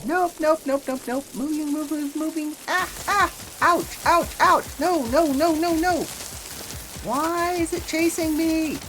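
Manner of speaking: high, loud panicked yelps; panicking gamer. Real, not acted. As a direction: scramble away in a panic. Storm chasing the player.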